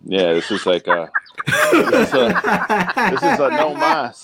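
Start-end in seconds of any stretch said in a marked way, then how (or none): 1.5-4.2 high, laughing through the words. Real, not acted. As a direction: giggle it out. Laughing at own failure.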